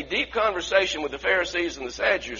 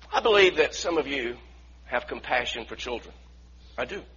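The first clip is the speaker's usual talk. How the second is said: usual speaking voice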